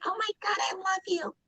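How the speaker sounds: high, soft sugary gush; sweet gushing. Shown as a demonstration. Friend showing love sweetly, high and gushing. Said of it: "did it sound like".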